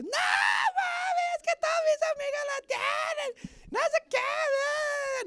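put-on very high, slow whining protest of a teenage girl; whiny teen daughter. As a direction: plead like a sulky teen girl defending her phone. Teen daughter argues with mother. (hija adolescente quejumbrosa, voz muy aguda y arrastrada)

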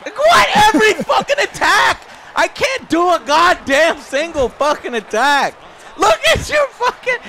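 furious shout. Real, not acted. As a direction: scream at the screen. Raging at the game.

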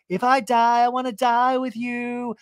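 high, held, loud declaration; mock melodrama. Mocking the friends' memorial montage, high melodrama.

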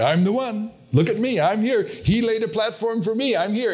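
sneering imitation of a self-proclaimed prophet; mock boastful pretender. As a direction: mimic a pushy would-be prophet showing off. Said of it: "tried to step on that platform and say".

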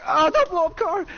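high, loud yell; panicked shout. Real, not acted. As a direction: yell it out in alarm. Car about to explode.

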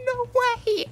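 high screamed no way; screaming no way. Real, not acted. Streamer gets second rare drop, screaming no way. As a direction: scream in disbelief.

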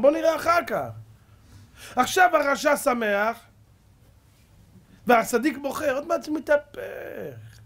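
high, loud, drawn-out exhortation; fiery preaching. Real, not acted. Preacher assuring the faithful that joy will follow, high impassioned shout. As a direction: thunder it out slowly to the congregation.

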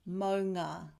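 "maunga" with the ng from the back of the tongue; ng sound. Shown as a demonstration. Teacher shows the ng in "maunga". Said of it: "the back of the tongue touches the roof of the mouth".

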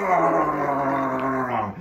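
drawn-out hammed-up roar; bear roar. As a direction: hold out a bear's roar. Bear grabs the woman, roars.

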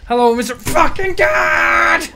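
slow, high, roaring rage at the boss; sarcastic screaming greeting; furious shout. Real, not acted. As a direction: bellow it out in pure fury. Raging at a tough enemy.

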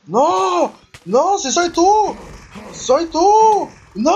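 loud, very high screaming; panicked scream. Real, not acted. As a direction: shriek in total panic. Killed by own character, panicking.

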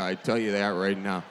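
usual speaking voice

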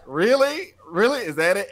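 high, amused disbelief; playful mock-disbelieving really; incredulous. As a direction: laugh it off, really?. Laughing incredulous retort to student.

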